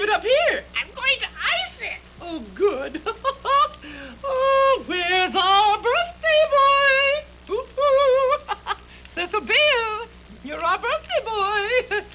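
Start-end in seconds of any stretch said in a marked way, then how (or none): 3.1-4.3 high, bubbling delighted laugh; a high giddy giggle. Acted. Greeting the birthday boy. 4.3-12.0 high, loud, slow sing-song coo; gushing to the birthday boy in a high drawn-out voice; cooing welcome. Acted.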